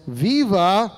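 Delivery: just sketch the announcer's "Viva!" lightly; quick half-voiced demo of the cheer; announcer's call. Shown as a demonstration. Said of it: "the announcer, yells".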